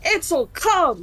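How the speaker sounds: high, urgent call across space; calling out loud. Acted. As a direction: yell for the familiar to come back. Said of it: "like, as loud as she can".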